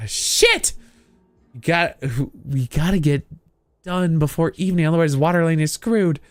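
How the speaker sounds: panicked outburst. Acted. Girl NPC panics.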